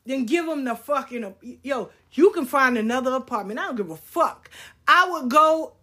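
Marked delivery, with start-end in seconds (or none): none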